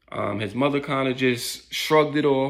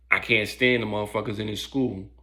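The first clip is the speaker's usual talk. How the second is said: ordinary delivery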